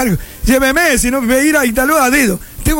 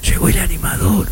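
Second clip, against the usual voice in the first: lower, hammed-up radio announcer intro; 5 semitones lower, 3 dB quieter than usual. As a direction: introduce yourself like a mock emcee.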